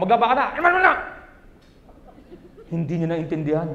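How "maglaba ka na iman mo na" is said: wife's order. Retold or imitated. Wife ordering husband to do laundry, mock command. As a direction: bark the chores like a nagging wife. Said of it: "Inutusan ako".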